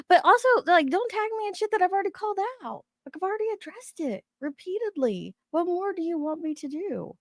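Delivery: high-pitched, rapid frustrated outburst; fed up, pitch shooting up, words tumbling fast; exasperated rant. Real, not acted. Streamer to chat about being tagged, exasperated high rant.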